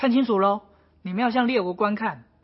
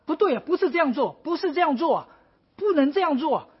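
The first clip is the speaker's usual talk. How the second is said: higher, fast, flustered protest; flustered objection; 6 semitones higher than usual. Retold or imitated. Habakkuk objecting to how God acts, flustered. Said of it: "哈巴谷又说".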